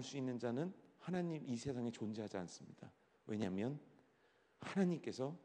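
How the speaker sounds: soft, earnest testimony; soft sincere testimony. Acted. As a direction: confess it softly and sure.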